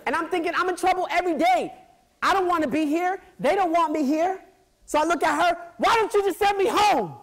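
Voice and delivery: high, loud pleading cry; anguished plea. Retold or imitated. Kid begging the vice principal to send him home, anguished. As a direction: beg like a kid at breaking point.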